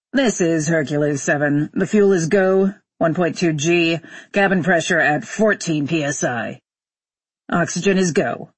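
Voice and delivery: businesslike pilot reading off status; astronaut on radio. Acted. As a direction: report each system to mission control.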